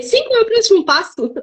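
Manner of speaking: spring the answer with delight; excited reveal. Real, not acted. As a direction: pitch jumps up on the reveal.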